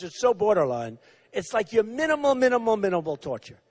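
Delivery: ordinary talk, nothing marked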